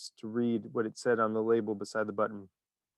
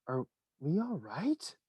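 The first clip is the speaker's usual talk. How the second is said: anxious cry; 4 semitones higher, 6 dB quieter, slower than usual. A narrator's reading. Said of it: "cried Grandpa Joe".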